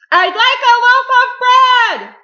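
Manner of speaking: very loud, high, drawn-out holler; booming shout. A narrator's reading. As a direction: boom it out at full volume. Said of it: "boomed Mister Noisy".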